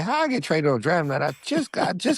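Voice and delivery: high, disbelieving how-did-this-happen; shocked disbelief. Retold or imitated. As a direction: replay the moment the trade news hit. Retold shock at draft-night trade.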